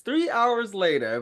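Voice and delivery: a hint of the SpongeBob title-card narrator. Guest quoting the SpongeBob time-skip narrator, lightly. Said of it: "the SpongeBob SquarePants".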